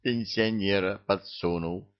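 usual speaking voice

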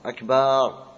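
أكبار with a long aa after the b; extra alif in akbar. Shown as a demonstration. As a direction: say أكبار, stretching the ba into baa. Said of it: "زيادة ألف بعد الباء". (أكبار بزيادة ألف بعد الباء)

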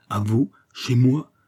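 slow, choked question; strangled voice. A narrator's reading. Fouquet holding himself back from Aramis, choked. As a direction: squeeze the words out slowly. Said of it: "dit-il d'une voix étranglée".

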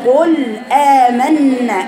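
قل آمنا very slowly, a clear break between ل and آ; slow separated recitation. Shown as a demonstration. Teacher models separating lam from hamza, slow. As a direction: space out قل آمنا, letter by letter. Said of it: "افصلي بين اللام والهمز".

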